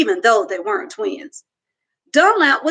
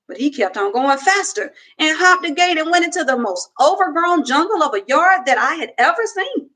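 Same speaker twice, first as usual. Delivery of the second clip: plain reading voice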